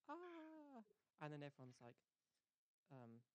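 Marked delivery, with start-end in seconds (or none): 0.1-0.8 high-pitched mock scream, held back; long high scream without real volume; imitated scream.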